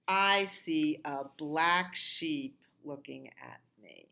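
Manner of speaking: ordinary talk, nothing marked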